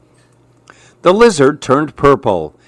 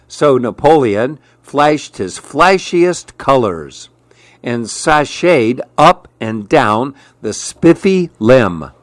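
ordinary delivery

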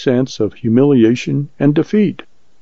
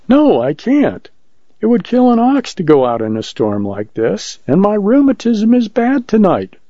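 high-pitched, fretful minister making excuses; little preacher refusing; 8 semitones higher than usual. Acted. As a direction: refuse in a raised, pleading pitch.

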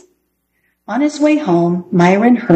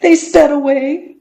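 put-on higher, tearful little girl; sobbing girl; 8 semitones higher than usual. A narrator's reading. Girl crying in the road about the car, sobbing. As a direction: choke it out, close to sobbing. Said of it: "Dana sobbed".

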